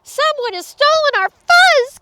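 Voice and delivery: high, loud alarmed cry; panicked little creature's outcry. A narrator's reading. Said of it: "cried Pip Squeak".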